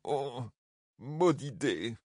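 soft muttered curse; muttering. Acted. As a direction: mutter it under your breath. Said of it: "marmonna-t-il".

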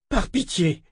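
high, moaning plea. A narrator's reading. Richard on his knees moaning a high plea to Callan. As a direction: beg her from your knees. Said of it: "gémit-il en tombant à genoux".

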